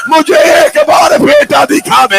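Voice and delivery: high, loud wailing cry; wailing plea. Acted. Wounded Husain begging his horse to take him to his son.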